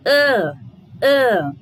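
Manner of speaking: long nasal ã, said loud and held; drawn-out nasal ã. Shown as a demonstration. Teacher sounding out the nasal ã for the class. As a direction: sound out ã through the nose like a teacher. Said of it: "o tio faz a gente fazer, fazer o som da letra".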